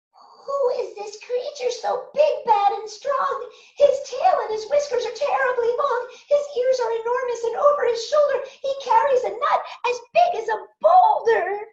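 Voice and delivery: high, loud, fast panicked cries; frightened by a giant shadow, rushing; terrified little Gruffalo. Acted. Gruffalo's child sees shadow.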